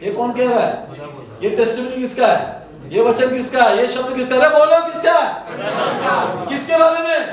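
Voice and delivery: rising, high-pitched prodding for an answer; insistent questioning. Real, not acted. Preacher prodding the congregation to answer, insistent and high. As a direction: press the crowd to shout it back.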